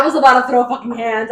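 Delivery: loud, heated mock outrage; indignant outburst. Co-host riled up by the heroine's complaint, indignant outburst. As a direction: burst out as if ready to fight.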